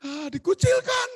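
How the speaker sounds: very high, drawn-out cry; exaggerated slow, high outcry; mock outraged exclamation. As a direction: wail it loud and high for comic effect. Exclaims over being shunned by neighbours.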